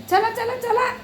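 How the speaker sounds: high, sing-song calling of the kittens; coaxing call to kittens. Real, not acted. Owner calling kittens out of the kitchen, high coaxing call. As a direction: coax them out in a high pet voice.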